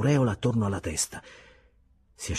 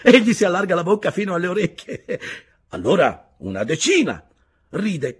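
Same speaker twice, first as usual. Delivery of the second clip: higher, louder, lively telling with laughs; cheerful storyteller figure; 12 semitones higher, 10 dB louder than usual. A narrator's reading. Fellow prisoner recalling Ulaanbaatar, lively and laughing. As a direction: tell it like a happy memory. Another prisoner tells an anecdote.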